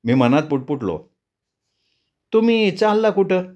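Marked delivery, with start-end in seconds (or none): 2.3-3.4 much higher, rising on the first word; eerie village woman. Acted. The woman answers the traveller, high and strangely calm. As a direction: speak with an odd calm.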